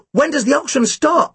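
high, urgent yell. Acted. Boy to Tim, yelling about the auction time. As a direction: yell the question in a rush. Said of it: "I yelled".

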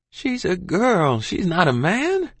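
high, slow protest; pleading mother. A narrator's reading. Mother argues with husband over daughter, pleading. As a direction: protest high, stretching the words.

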